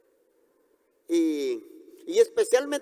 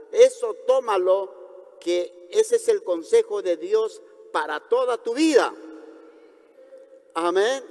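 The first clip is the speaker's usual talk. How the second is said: ordinary delivery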